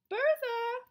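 high, loud call of a name. A narrator's reading. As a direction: call out to get her attention. Teacher calls out to monitor.

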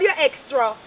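ordinary delivery

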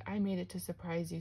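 usual speaking voice

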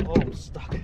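normal talking voice

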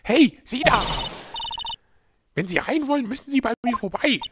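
put-on grumpy old lady voice. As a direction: bark it like a nosy old guard. Player voices the guard Oldbag.

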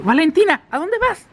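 high-pitched scolding call; calling out sharply. Real, not acted. Owner ordering a wanderer back inside, high scolding call.

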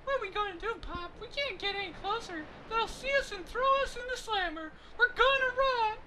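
falsetto daughter fretting, quick; high worried girl. Acted. Daughter afraid of the prison guards, high fast falsetto. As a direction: rush it out high and anxious.

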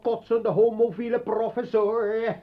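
yelling slow, loud and high; furious shout. Acted. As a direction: spit the insult out at full volume. Scheldt de professor uit. (woedend schreeuwen)

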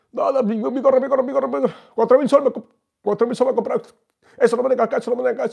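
very high, loud, frantic wail. Retold or imitated. Celebrity wailing to the teller about his stolen cap, very high and loud.

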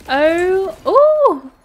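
high, loud delighted squeal; excited cheer. Real, not acted. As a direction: squeal with relief and joy. Surviving the round.

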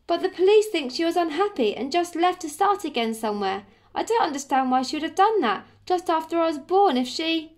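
upset protest. A narrator's reading. Tilly questions grandparents about her missing mum, upset.

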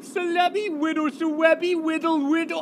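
"whittle" said with w for l, high and cooing; baby talk. Acted. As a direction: coo "celebby" and "whittle" high, baby style.

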